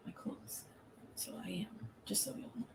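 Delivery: very soft, near-whispered aside; hushed aside. Real, not acted. Telling viewers about her clothes, hushed. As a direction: drop to almost a whisper.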